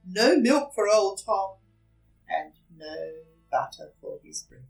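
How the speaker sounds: ordinary delivery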